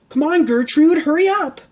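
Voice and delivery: high, loud urging; girl yelling hurry; urgent shout. Acted. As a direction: hurry him up. Pancake urging.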